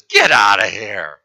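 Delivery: low, slow captain's shout; gruff dismissal. Acted. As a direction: bark him off the ship.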